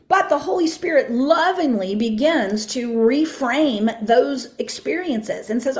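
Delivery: ordinary delivery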